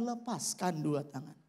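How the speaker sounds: calm God repeating gently. Retold or imitated. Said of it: "Suara itu datang lagi". (suara Tuhan yang lembut)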